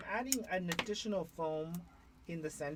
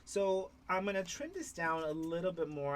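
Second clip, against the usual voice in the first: ordinary delivery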